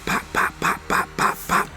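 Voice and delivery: breathy unvoiced pops like gunfire; voiceless mimicked gunfire bursts; gunshot pops. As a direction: spit out the shots like a pistol going off. Imitating gunfire in the avenue.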